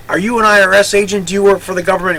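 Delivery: high, loud, worried questioning; suspicious alarm. Retold or imitated. Clerk nervously asks Walter if he is an IRS agent. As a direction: grill him like he might be a fed.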